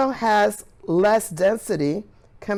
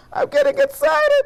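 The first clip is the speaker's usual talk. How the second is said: much higher, thrilled squeak; excited squeal. Real, not acted. Teacher sharing her thrill with the kids, high excited squeal. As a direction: let the excitement burst out high.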